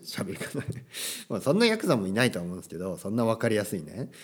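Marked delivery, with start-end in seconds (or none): none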